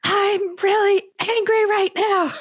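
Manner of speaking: high, slow acted yell of anger; retold yell. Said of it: "I was able to yell".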